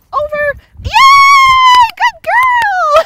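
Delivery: high squealing yay, very loud; delighted shout. Real, not acted. Trainer shrieking with joy as her dog clears the jump.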